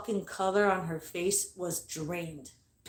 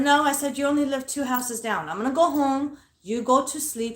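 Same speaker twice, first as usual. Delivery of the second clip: higher, insistent quoted refusal; retold firm refusal; 7 semitones higher, 5 dB louder than usual. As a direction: put your foot down, pitched up. Retold refusal to stay over.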